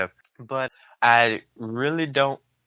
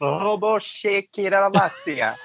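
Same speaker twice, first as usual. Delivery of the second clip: mock speaking in tongues; 10 semitones higher than usual. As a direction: fake your way through tongues, rolling the R's. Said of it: "I just started faking it".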